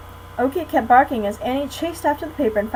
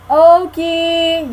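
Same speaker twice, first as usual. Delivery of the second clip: loud, drawn-out yell; 6 semitones higher, 8 dB louder than usual. A narrator's reading. Jack yelling for the lost puppy. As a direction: yell long into the woods. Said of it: "yelled Jack".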